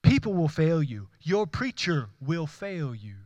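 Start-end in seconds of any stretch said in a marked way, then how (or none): none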